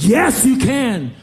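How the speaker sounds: high, loud, slow declaration; slow booming yes. Retold or imitated. Answering the stunned boy, slow and loud. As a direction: stretch out each word, booming.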